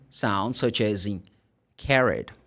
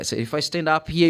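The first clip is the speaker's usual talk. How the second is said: ordinary talk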